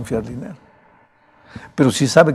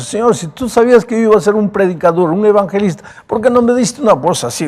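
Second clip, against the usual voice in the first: high, loud pleading to God; complaining prayer; 7 semitones higher, 6 dB louder than usual. Retold or imitated. Preacher complains to God about his voice, pleading. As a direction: question God like a frustrated young preacher. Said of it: "Yo siempre le preguntaba a Dios".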